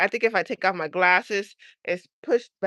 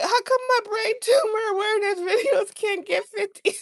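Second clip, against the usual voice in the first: laughing wail; 13 semitones higher than usual. Retold or imitated. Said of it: "I was crying to my boyfriend".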